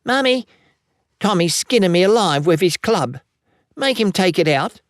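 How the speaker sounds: put-on high-pitched little child's complaint; small child telling on a brother; protesting child. A narrator's reading. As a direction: appeal to Mummy in a high, aggrieved voice. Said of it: "and Jackie protests".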